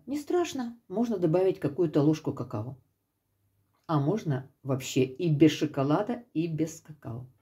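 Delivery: usual speaking voice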